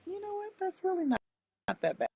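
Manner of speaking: high, gentle and sweet; soft sweet voice. Retold or imitated. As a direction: let it go softly and sweetly. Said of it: "The softer, sweeter side of me".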